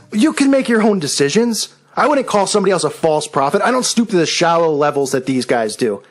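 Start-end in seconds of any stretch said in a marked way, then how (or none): none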